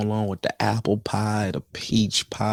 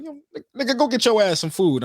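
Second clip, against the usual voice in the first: put-on drunk uncle voice; 9 semitones higher, faster than usual. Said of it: "He's like".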